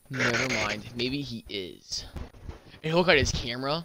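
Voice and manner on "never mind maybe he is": lower, subdued backing down; reluctant concession. Retold or imitated. Imitated friend backing down on defending him, deflated. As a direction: trail off slowly and quietly, conceding. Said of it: "And he'd be like".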